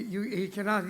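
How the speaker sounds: ordinary talk, nothing marked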